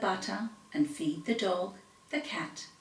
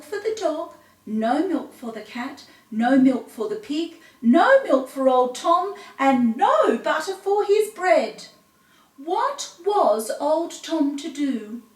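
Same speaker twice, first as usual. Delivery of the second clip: higher, louder mock lament; dramatic despair; 5 semitones higher, 5 dB louder than usual. A narrator's reading. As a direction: wail the lament. Despairing listing again.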